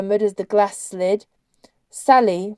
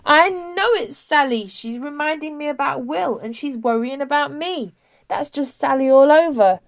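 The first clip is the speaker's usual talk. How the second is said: raised, insistent pitch with emphasis; higher-voiced, emphatic protest; 6 semitones higher than usual. A narrator's reading. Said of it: "with her elbows for emphasis".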